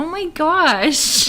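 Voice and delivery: slow, high, drawn-out gasp; mock shock. Real, not acted. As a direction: overplay the outrage. Mock outrage at spoiler.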